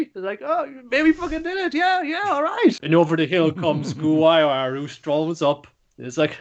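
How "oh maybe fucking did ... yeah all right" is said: very high, squeaky cartoon-critter cheer; squeaky celebration. The little mascots after beating the king, squeaking very high with joy. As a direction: squeal it out like a tiny excited mascot.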